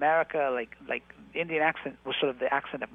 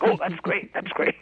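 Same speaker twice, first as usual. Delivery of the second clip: "Oh, that's great" pitched higher and louder, gushing; mock eager; 6 semitones higher, 7 dB louder, slower than usual. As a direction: gush like an eager producer. Producers' eager reply, retold.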